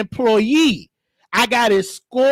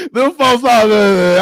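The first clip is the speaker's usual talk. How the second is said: real laughter breaking into the words; cracking up; 2 semitones higher, 5 dB louder, slower than usual. Real, not acted. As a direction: try to talk through a loud laughing fit. Cannot stop laughing at story.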